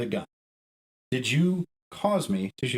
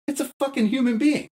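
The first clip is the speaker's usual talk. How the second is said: much higher, louder cry of "human being"; emphatic outburst; 13 semitones higher, 3 dB louder than usual. Retold or imitated. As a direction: burst out with it, pleading hard. Climax of his imagined plea.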